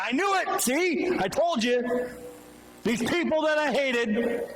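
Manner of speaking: bitter told-you-so. Retold or imitated. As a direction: spit it out like a man proven right and furious about it. Jonah angry that Nineveh is spared.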